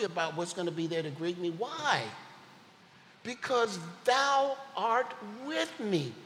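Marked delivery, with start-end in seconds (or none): 1.5-6.1 high, louder and slowed on the answer; exultant proclamation. Acted. Preacher answering his own question to the church, exultant. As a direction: ring it out as the answer to everything.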